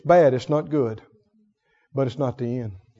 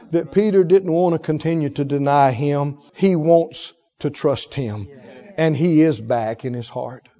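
ordinary delivery

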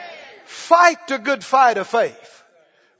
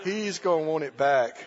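mock worried thought; 10 semitones lower, 10 dB quieter than usual. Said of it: "And she thought".

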